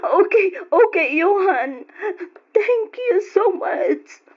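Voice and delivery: grateful sobbing thanks; aunt thanking her nephew through happy tears; relieved tears. Acted. Crying with relief on call.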